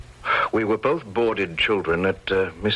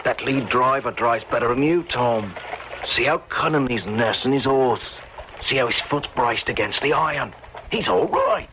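voiced common soldier, pitched up; artillery soldier; 4 semitones higher than usual. One soldier to his mate at the shop window, soldier's voice. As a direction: play a keen soldier admiring a battle picture. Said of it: "one was saying to the other".

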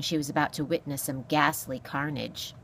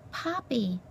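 small, high mouse voice; timid mouse; 6 semitones higher, 4 dB quieter than usual. Acted. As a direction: answer meekly as a little mouse. Timid mouse answers gruff beast.

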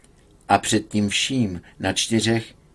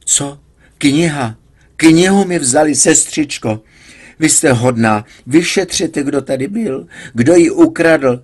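frantic complaint; 6 semitones higher, 7 dB louder than usual. A narrator's reading. Patient frantic about stolen book.

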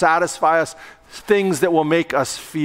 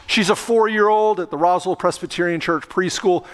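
ordinary delivery

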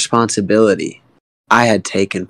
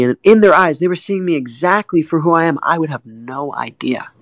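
ordinary delivery

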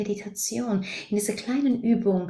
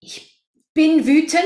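"ich bin wütend" said higher and louder, rising on "bin"; identified with anger; 7 semitones higher, 7 dB louder than usual. Shown as a demonstration. Coach contrasts being angry with feeling anger, "ich bin wütend" loud and high. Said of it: "es hört sich ganz anders an, ob ich sag".

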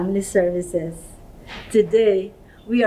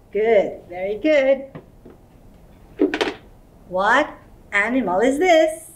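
ordinary delivery

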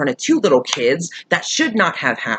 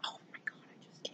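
whispering; 32 dB quieter than usual. Real, not acted. Overwhelmed, voice drops away.

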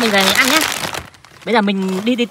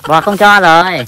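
slow, loud mock refusal for the cow; pretend cow refusing; 5 semitones lower, 8 dB louder, much slower than usual. Friend voicing the cow saying no, slow and loud. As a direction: answer for the cow, drawn out and firm.

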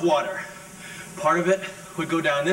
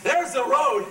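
hurt dismissal; 7 semitones higher than usual. Acted. Sends his cat away in quarrel.

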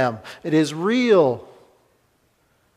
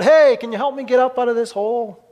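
higher, louder call up out of a hole; a mock shout up from a pit; shout for help; 7 semitones higher, 3 dB louder than usual. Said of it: "he shouts up to the poet".

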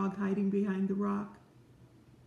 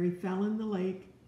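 ordinary delivery

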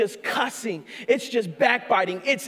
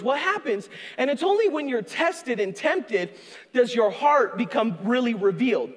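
ordinary delivery

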